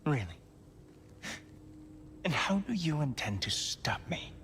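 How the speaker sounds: whispered challenge. Acted. God facing the newcomer.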